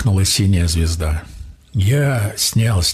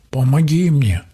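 mild plea, pitched up; pleading; 6 semitones higher than usual. A narrator's reading. Stranded water creature begs the man for help, mildly pleading. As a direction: beg lightly for help. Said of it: "взмолился водяник".

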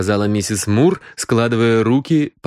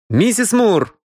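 calling out; 11 semitones higher, slower than usual. Acted. Calling for the housekeeper.

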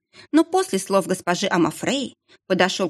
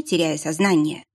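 usual speaking voice

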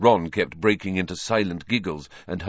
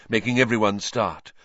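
plain reading voice

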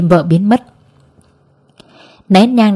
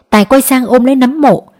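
ordinary delivery

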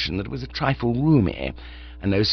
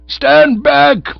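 higher, loud warning shout; 11 dB louder than usual. Acted. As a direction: bellow a warning brandishing an umbrella. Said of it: "he shouted".

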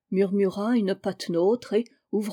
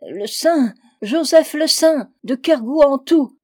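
loud, raised desperate yell; howling; 5 semitones higher, 7 dB louder than usual. A narrator's reading. Trapped drunkard identifies himself to Fanta, howling. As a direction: howl out your name from below. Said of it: "hurla le malheureux".

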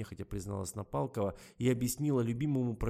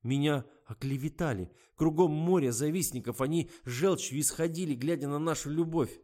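indignant; 7 semitones higher, 7 dB louder than usual. A narrator's reading. Lena protests tearfully.

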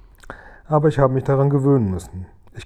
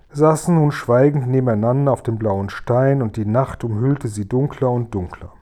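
ordinary delivery, nothing marked

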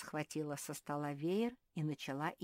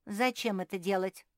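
louder, higher wondering question; surprised; 4 semitones higher, 7 dB louder than usual. A narrator's reading. Marie questions holding water in her mouth, surprised. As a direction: ask with mild astonishment. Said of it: "удивилась Мари".

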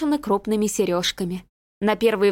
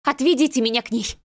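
raised, urgent demand; crying out; 4 semitones higher than usual. A narrator's reading. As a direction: cry out to be taken to her daughter. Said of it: "вскочила с криком". (с криком, настойчиво)

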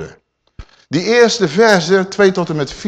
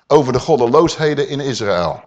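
ordinary talk

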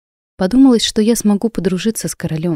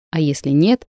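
ordinary delivery, nothing marked

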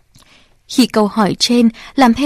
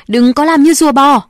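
louder, higher shouted order; 4 semitones higher, 6 dB louder than usual. Acted. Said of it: "Bố Trần Tháp hét". (quát to)

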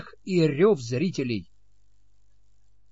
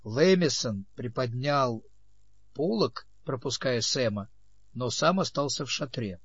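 usual speaking voice

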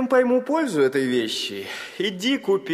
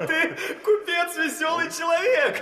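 laughing; 8 semitones higher than usual. Acted. Caliph amused by merchant's song.

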